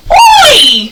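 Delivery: high, loud leaping Oi with a laugh; shouted Oi. Shown as a demonstration. Teacher leads class leaping, shouting Oi. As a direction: shout it as you leap. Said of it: "So everyone try it".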